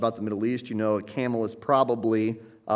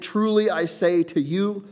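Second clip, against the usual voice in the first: TRULY said high, loud and drawn out; slow, weighty declaration with TRULY stressed; emphatic; 7 semitones higher, 5 dB louder than usual. Acted. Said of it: "Christ is emphatic".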